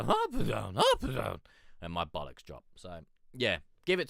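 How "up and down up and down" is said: voice breaking. Retold or imitated. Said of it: "I was like".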